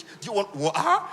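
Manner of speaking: slow, prim Queen's English offer of water. Retold or imitated. Woman to her crush's friend, in put-on Queen's English. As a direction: put on a proper British accent to sound classy. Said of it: "you're speaking Queen's English".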